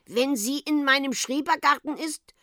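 put-on high, nagging old woman voice; quarrelsome. A narrator's reading. Old woman arguing by phone with uncle, quarrelsome. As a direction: argue the point querulously. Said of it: "sagte das Weib zänkisch".

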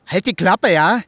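loud, furious shut-up; shouting at the neighbour to shut up; angry shout. Acted. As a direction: bark it at him.

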